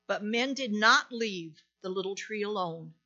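usual speaking voice

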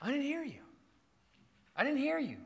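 put-on child's excuse. Kid excusing himself to dad, mock child voice. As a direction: imitate a kid's excuse, high then falling. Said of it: "what is the response every time".

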